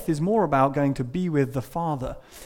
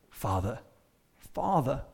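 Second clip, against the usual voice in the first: tender; 4 semitones lower, 9 dB quieter than usual. Retold or imitated. Jesus addressing God tenderly.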